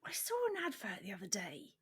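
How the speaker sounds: whispered aside; whispering. Real, not acted. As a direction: whisper it confidentially.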